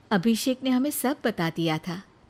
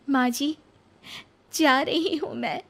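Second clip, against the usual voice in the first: put-on lightly raised young woman's voice; a hint of young Nisha; 6 semitones higher than usual. Nisha touching mother-in-law's feet, sad goodbye.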